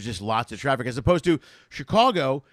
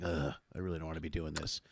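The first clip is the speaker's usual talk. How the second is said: much lower and softer, a tired mutter; weary grumble; 8 semitones lower, 13 dB quieter than usual. Retold or imitated. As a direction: grumble it under your breath like you dread the drive. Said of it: "and I'm like".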